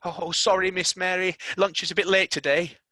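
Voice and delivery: a hint of a sorry servant; apologetic maid. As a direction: maid apologising to Mary about lunch. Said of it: "Martha apologised".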